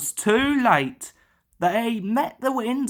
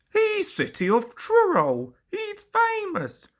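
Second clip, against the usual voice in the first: higher, earnest little engine; Duck voice; 10 semitones higher than usual. A narrator's reading. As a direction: say it with proud loyalty. Duck defends his friend.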